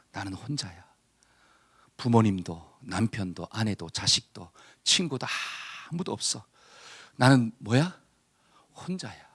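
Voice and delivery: low, soft, dejected murmur; lonely despair. Acted. Preacher voicing a person left all alone, low and dejected. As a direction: voice a forlorn soul, sinking to near whisper.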